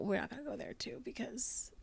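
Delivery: hushed unvoiced whisper; whispered aside. Real, not acted. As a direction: whisper it like a secret. Aside, gossip.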